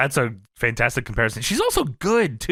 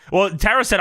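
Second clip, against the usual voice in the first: ordinary delivery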